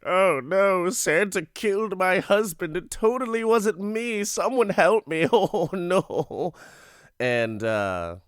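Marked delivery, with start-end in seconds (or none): 0.1-6.5 high, loud hammed-up cry for help; overacted, wailing damsel plea; mock panic. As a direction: feign distress to the police, badly faked. Fake distress call to police.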